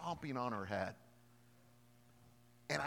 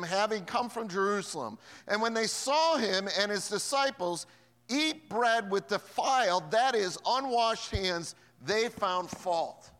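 scripture reading; 5 semitones higher, 9 dB louder than usual. Acted. Reads scripture.

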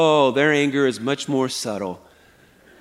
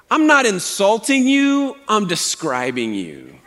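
self-righteous sarcasm; 4 semitones higher than usual. Acted. Preacher imitating his favorite passive-aggressive comment, self-righteously sarcastic. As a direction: act a smug passive-aggressive correction. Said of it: "Very self-righteous".

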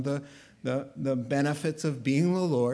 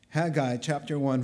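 ordinary delivery

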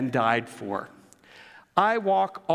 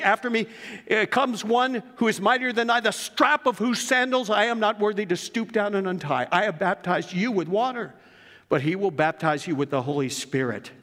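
proclaiming John the Baptist; 5 semitones higher than usual. Acted. Preacher voicing John the Baptist, ringing proclamation. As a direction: cry it out like a prophet in the desert.

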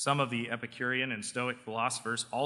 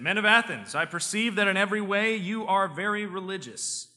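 raised, preaching Paul addressing Athens; 3 semitones higher than usual. Acted. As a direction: proclaim it to the crowd like Paul. Paul's speech read as preaching.

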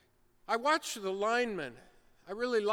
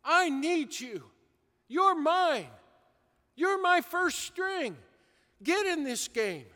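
raised, pleading call to get up; the Lord urging; 6 semitones higher, 4 dB louder than usual. Retold or imitated. The Lord calling a sinner off the bench, urging. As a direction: urge him back into the game. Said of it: "He's saying".

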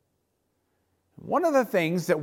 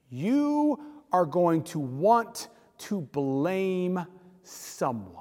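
ordinary delivery

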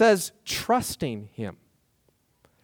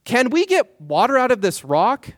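raised, pointed rhetorical ask; rhetorical challenge; 6 semitones higher, 3 dB louder than usual. Retold or imitated. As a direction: Moses challenging the people rhetorically. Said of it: "rhetorical question".

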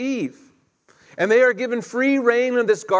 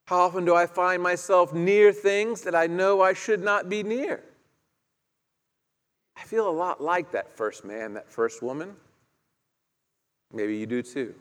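ordinary talk, nothing marked

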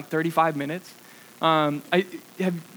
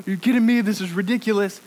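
higher and louder, mock outrage; exasperated disbelief; 4 semitones higher, 4 dB louder than usual. Retelling his reaction to the out-of-order bathroom, mock outrage. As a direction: throw up your hands at the closed bathroom.

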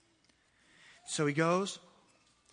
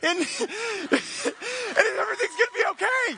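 overexcited disciple; 10 semitones higher than usual. Acted. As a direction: gush the news like you can't stop. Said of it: "no doubt excited".

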